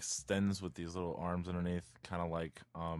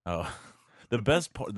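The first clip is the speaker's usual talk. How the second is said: normal talking voice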